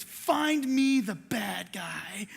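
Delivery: low, slow, stern cop voice; lightly voiced police officer. Police officer giving Johnny an order, low and slow. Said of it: "He says".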